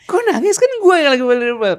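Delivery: very high, loud "why are you crying"; hammed-up disbelief; mock bewildered. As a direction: play baffled and annoyed. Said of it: "terus nangis".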